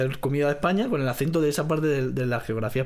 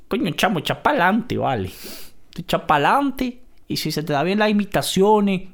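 put-on Venezuelan accent; 3 semitones higher, 3 dB louder than usual. Venezuelan malandro voice advising would-be dubbers. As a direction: give advice like a Venezuelan homie. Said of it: "despídenos con algo así".

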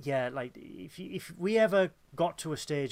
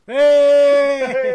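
excited cheer; 9 semitones higher, 16 dB louder than usual. Real, not acted. Jubilant cheer.

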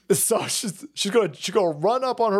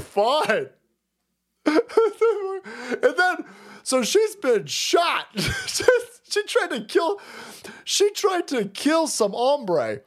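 ordinary talk, nothing marked

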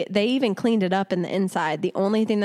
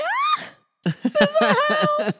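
much higher, slow thrilled shout; excited squeal; slower than usual. Retold or imitated. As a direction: squeal it with delight. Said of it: "I'm like".

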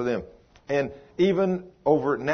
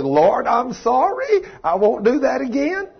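contrite apology; 11 semitones higher, 5 dB louder than usual. Retold or imitated. Pastor mocking his own apology to God, high and loud. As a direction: overplay the sheepish repentance. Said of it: "And I said".

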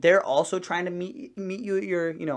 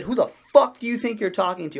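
put-on loud, higher-pitched outburst at a kid; retold angry yell; 7 semitones higher, 8 dB louder than usual. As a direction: snap loudly, as if confronting him. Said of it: "I was still, like, yelling at him".

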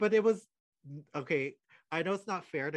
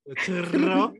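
laughing; 12 semitones higher, 7 dB louder, slower than usual. Real, not acted. Teasing friend finishes sentence.

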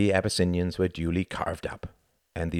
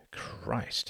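whispered curse; 2 semitones higher, 11 dB quieter than usual. A narrator's reading. Muttered under breath.

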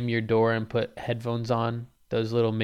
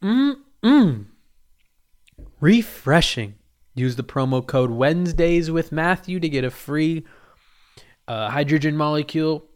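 louder, hyped sponsor pitch; ad read; 4 semitones higher, 8 dB louder than usual. Acted. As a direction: sell it like a cheesy radio ad.

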